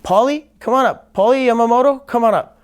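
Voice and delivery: called out high and loud; calling out. Retold or imitated. Teacher calling a student up to spar, loud call. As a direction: boom it across the hall like a teacher. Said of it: "and then he goes".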